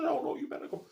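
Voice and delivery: high mock of mum being secretive; mocking mother. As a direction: mimic her coy excitement. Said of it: "She said".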